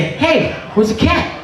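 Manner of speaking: high alarmed call; high urgent hey. Retold or imitated. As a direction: call out sharply, looking for the cat. Calling out for missing cat.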